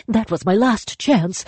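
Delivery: high, falling despair. Acted. Spirit mourns its lost chance, high. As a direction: say it as the end of hope.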